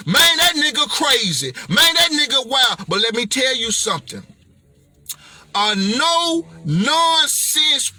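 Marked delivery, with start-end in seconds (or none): none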